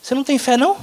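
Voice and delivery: high, scolding mock voice; high, scolding mock of a judgmental churchgoer. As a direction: mimic a preachy critic, pitch way up. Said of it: "E tem gente que fala assim".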